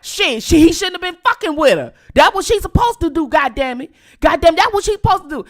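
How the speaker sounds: loud, high, fast rant as the uncle; uncle's rant. Retold or imitated. Uncle voiced defending niece.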